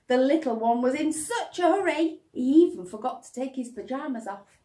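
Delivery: ordinary delivery, nothing marked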